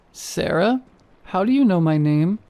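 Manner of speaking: startled question, voice jumps up; suddenly scared and suspicious; alarmed. Acted.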